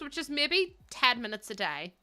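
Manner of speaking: normal talking voice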